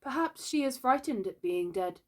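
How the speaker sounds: high, hesitant musing; uncomfortable. A narrator's reading. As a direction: offer an uneasy guess. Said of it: "he said uncomfortably".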